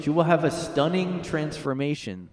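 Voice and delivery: hammed-up doom announcement; mock prophecy. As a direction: intone it like a prophecy. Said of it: "I'm just gonna predict it".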